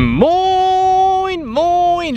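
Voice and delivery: high, drawn-out sing-song hello; sing-song greeting. Acted.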